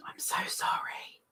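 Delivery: very soft whisper; hushed, breath-only sorry; whispered apology. Real, not acted. Apologises quietly for another ad.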